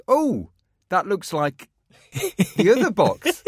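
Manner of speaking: a hint of wife's laughing surprise; surprised wife. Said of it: "my wife goes".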